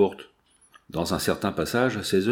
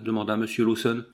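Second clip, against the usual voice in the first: usual speaking voice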